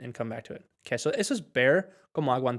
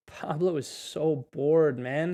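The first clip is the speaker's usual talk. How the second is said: slow; much slower than usual. Shown as a demonstration. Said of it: "teacher models sentence slowly".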